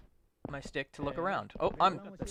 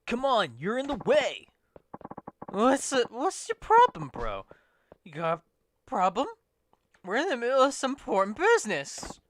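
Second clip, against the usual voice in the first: put-on rowdy drunk picking a fight; drunk thug voice; 8 semitones higher, 7 dB louder than usual.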